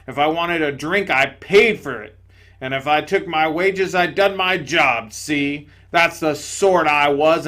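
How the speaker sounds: loud, gruff self-righteous ghost; boastful big ghost. Acted. Big ghost to the bright spirit, loud boasting. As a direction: brag loudly about his decency.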